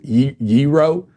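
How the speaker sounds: "gyro" as a slow YEE-roh; pronounced with Y. Retold or imitated. Host tries the counterman's version, slowly, YEE-roh. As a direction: draw out "gyro" with a Y, feeling it out. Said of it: "like, with a Y".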